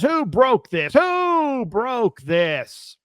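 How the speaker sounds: high, drawn-out mock indignation; outraged priest. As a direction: stretch it out like a scandalized priest. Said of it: "he says".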